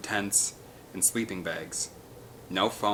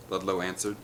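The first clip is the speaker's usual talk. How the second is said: usual speaking voice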